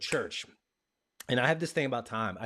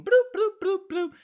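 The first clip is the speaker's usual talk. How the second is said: high, loud bloops; game sound imitation; 8 dB louder, slower than usual. Mocking the phone game in his head, high loud bloops. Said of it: "I'm like".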